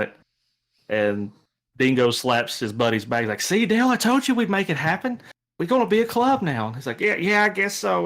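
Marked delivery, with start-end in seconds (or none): 3.4-6.7 high-pitched, hyped-up jock voice; excited jock buddy. Acted. Plays the jock buddy Dingo. 7.0-8.0 high-pitched, hesitant agreement; meek, anxious nerd; nervous nerdy kid. Acted. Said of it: "very nervous energy".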